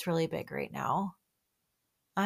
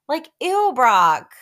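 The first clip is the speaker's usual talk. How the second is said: disgusted ew; 7 semitones higher, 10 dB louder, slower than usual. Real, not acted. Disgust at a cast member.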